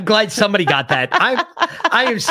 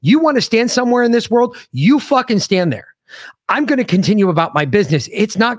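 heated rant; 7 semitones higher than usual. Retold or imitated. Telling off the door guard, heated raised rant. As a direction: lay into him, voice climbing.

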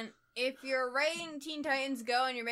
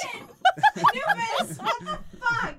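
laughing exasperation; 10 semitones higher, 10 dB louder than usual. Real, not acted. Pet follows her to bathroom.

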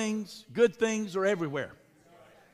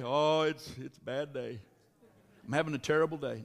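lower, much softer sad-sack moan; mock gloomy complainer; 7 semitones lower, 4 dB quieter than usual. Pastor mimicking a churchgoer moaning about a bad day, in a low, soft mopey voice. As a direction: sag into a mock mopey grumble.

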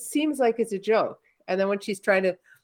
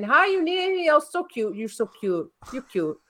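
mock cooing compliments, sinking from high to usual pitch; store-clerk imitation; 8 semitones higher than usual. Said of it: "she's got this accent".

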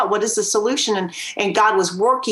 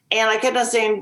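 ordinary talk, nothing marked

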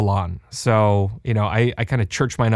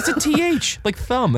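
much higher, goofy mock voice with a laugh; mocking the buddy; 13 semitones higher than usual. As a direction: make the friend sound silly. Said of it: "He goes".

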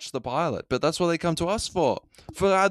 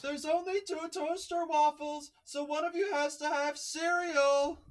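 much higher, softer, slow mother voice; mimicking Lois; 13 semitones higher, 7 dB quieter, slower than usual. Cohost copies the host's Lois, high and soft. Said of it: "I gotta mimic your Lois".

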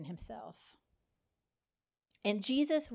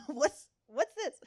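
slow, higher mock-startled gasp of a question; startled reaction; 6 semitones higher, 3 dB louder than usual. Preacher voicing people startled by an angel. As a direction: act taken aback at a sudden vision. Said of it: "we're gonna have a reaction".

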